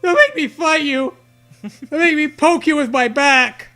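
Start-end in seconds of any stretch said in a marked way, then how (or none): none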